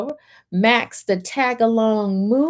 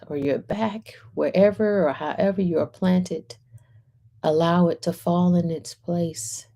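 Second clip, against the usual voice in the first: centering meditation; 3 semitones lower than usual. Acted. Host leading a centering moment before reading, calm meditation voice. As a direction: guide listeners into stillness, unhurried. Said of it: "I'm gonna do a quick centering moment".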